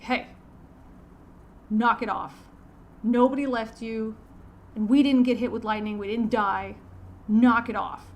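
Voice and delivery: loud, sharp bark to knock it off; barked order. Retold or imitated. Marine barking at two arguing men to stop. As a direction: snap at them like a drill sergeant. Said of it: "I just kind of barked at him".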